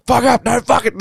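high, loud mock gamer rage; high shrieking imitation of a raging gamer; raging yell. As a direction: yell it like a furious teen gamer. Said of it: "I'm like".

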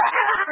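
high yelp of a mock scream, a retold scream; sudden high mock scream. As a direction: shriek it like a panicked wizard in the street. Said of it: "he starts fucking screaming in a crosswalk".

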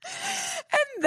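high, slow, laughing drawl; stretched out through giggles. Real, not acted. Telling the phone story while laughing, high and slow.

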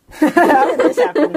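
laughing. Real, not acted. Laughing at leader choice.

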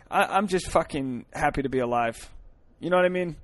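ordinary talk

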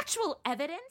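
high, scoffing echo of his words; mocking echo. Madison mocking Friday's demand for evidence. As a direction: throw his phrase back at him, incredulous. Drama clip, mocking retort.

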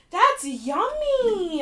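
loud, high, stretched-out raving; delighted exclamation. Real, not acted. Tasting the kiwi drink, delighted. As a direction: draw it out slowly with loud delight.

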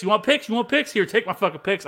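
high, rising mock offer of picks; mock taunting offer. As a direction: toss the picks at them, taunting.

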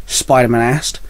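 ordinary delivery

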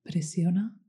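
whispered press and release cues; guided meditation. Acted.

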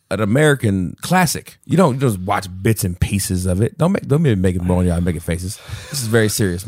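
ordinary talk, nothing marked

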